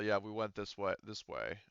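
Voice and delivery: ordinary talk, nothing marked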